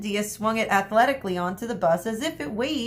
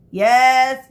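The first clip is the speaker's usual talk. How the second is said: impatient interruption; 5 semitones higher, 11 dB louder than usual. Acted. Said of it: "interrupted Miss Medea".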